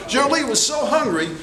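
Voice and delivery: plain reading voice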